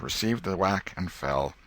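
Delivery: ordinary delivery, nothing marked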